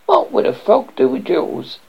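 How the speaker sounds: high-pitched puzzled frog; wondering frog. A narrator's reading. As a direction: wonder aloud as a little frog. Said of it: "he wondered".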